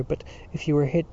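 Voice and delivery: plain reading voice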